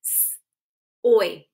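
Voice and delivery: "S-" then "oy" split apart; tapped sounds. Shown as a demonstration. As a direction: tap out "S-, oy". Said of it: "Tap it".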